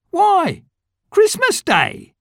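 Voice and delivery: put-on high, slow boyish reply; a young boy stating the obvious; boy's voice. A narrator's reading. Street boy answering Scrooge, high boyish voice.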